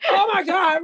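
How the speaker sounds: high-pitched comic "oh no" reaction; exaggerated retold alarm; mock dismay. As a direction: play up the horrified disbelief. Acting out worried reaction to customer crying.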